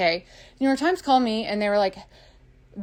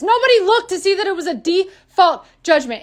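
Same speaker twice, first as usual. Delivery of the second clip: indignant; 8 semitones higher, 4 dB louder than usual. Real, not acted. Ranting about unfair judgment.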